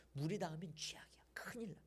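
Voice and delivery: hushed urgent whisper; whispered warning. Acted. Warning Jeremiah the belt must stay dry, whispered. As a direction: whisper the danger like a secret.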